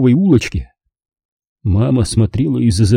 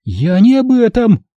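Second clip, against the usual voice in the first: high shout stressing не; 11 semitones higher than usual. Acted. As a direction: cut him off with a shout. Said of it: "закричала она". (мать кричит на сына)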